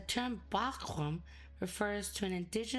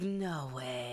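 "No way." said low; "No way." low and dismissive; lower no way; 8 semitones lower than usual. Retold or imitated. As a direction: drop it low and flat. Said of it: "it's like".

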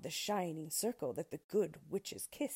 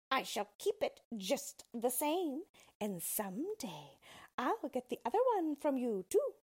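taunting witch; 5 semitones higher, 3 dB louder than usual. Acted.

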